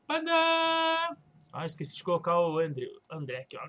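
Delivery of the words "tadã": fanfare tadã. Acted. Unveiling the paintings.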